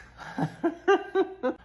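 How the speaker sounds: high burst of real laughter; high, loud helpless laughter. Real, not acted. Big laugh after clip.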